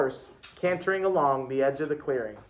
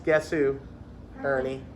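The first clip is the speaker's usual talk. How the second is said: plain reading voice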